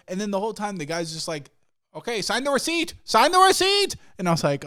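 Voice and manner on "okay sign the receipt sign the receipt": high, insistent demand building to a shout; mock yell, rising in pitch and volume; imitated yelling. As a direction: badger them loudly, mocking a pushy owner. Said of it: "Dude's yelling at me to get my signature".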